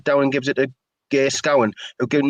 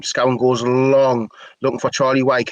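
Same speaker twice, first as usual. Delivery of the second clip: normal talking voice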